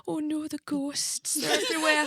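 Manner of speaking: high, slow mock-frightened wail; slow high mock ghost panic; mock scared Cheryl. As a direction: whimper about the ghosts in a high voice. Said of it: "hear her go".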